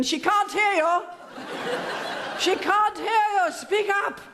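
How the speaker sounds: high, mock yell across a field; shouting. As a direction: call out from far behind, high-pitched. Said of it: "the lady's back here shouting".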